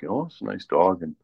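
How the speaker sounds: ordinary delivery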